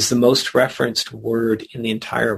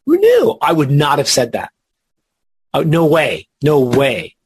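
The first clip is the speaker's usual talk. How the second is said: mock surprise; 3 dB louder than usual. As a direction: play the amazed, disbelieving reader. Feigns surprise at the top word.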